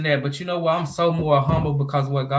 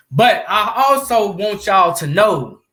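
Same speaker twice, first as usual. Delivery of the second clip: emphatic announcement; 8 semitones higher, 5 dB louder, slower than usual. Real, not acted. Emphatic call to viewers.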